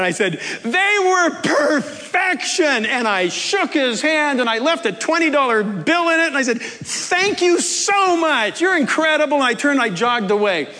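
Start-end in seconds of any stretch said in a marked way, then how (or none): 0.6-2.8 high, slow, laughing rave; delighted high shout of praise; yelled praise. Retold or imitated. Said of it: "I just yelled out". 6.6-9.4 high thrilled thank-you; gushing thanks. Retold or imitated. Thanking the cook.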